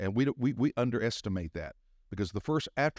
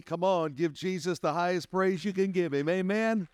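raised, ringing praise call; rally the church to praise; high-pitched call to worship; preaching exhortation; 8 semitones higher than usual. Real, not acted.

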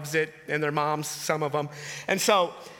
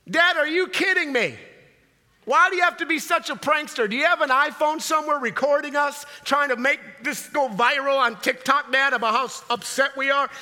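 higher-pitched mock indignant rant; upset daughter; 6 semitones higher, 4 dB louder than usual. Second daughter to her prankster dad, mock upset. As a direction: fire off a hammed-up complaint at dad. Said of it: "They're all upset".

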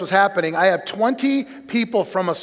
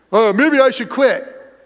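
imitating the pastor; 3 dB louder, slower than usual. Pastor threatening to quit again.

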